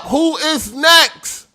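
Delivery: very slow, high, loud drawn-out shout; mock ringmaster summoning the next one; barker call. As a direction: bellow it to the whole crowd. Mock barker calling next challenger.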